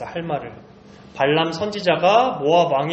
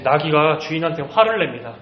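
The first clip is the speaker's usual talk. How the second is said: usual speaking voice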